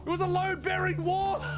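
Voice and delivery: high shout while laughing. Real, not acted. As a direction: yell it, half laughing. Shouting after a blunder.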